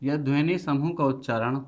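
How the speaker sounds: teacher dictating a definition to the class; slow dictation. Acted. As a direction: dictate word by word with long pauses so students can write.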